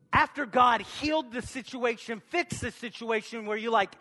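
preacher warming up; rising preacher fervour. Acted. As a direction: build the fire in the sermon. Preaching builds to mock prayer.

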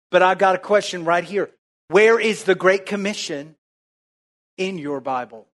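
ordinary talk

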